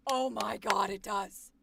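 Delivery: ordinary talk, nothing marked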